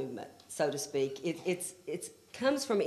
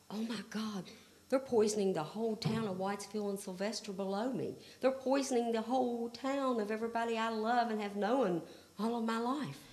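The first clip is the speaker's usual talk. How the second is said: soft, raised voice of dawning horror; retold dismay; 4 semitones higher, 6 dB quieter than usual. Activist realizing her town is being poisoned, hushed dismay. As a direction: relive the dismay, hushed and a little higher. Retells own horrified realization.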